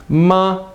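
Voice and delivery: "ma" held high and level, steady pitch; steady first tone. Shown as a demonstration. Teacher showing the first tone for mom, high and steady. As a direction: say "ma" on one high, even note. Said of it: "your voice just stays steady".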